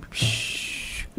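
long hiss of air; soft, voiceless whistle-like exhale; awed "pshhh". Real, not acted. Preacher impressed by such forbearance, awed hissing exhale. Wordless sound of awe.

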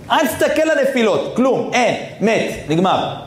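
high, emphatic preaching; impassioned exhortation. Acted. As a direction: urge it forcefully at high pitch. Preacher urges crowd to ignore falls. (דרשה נחרצת בקול גבוה)

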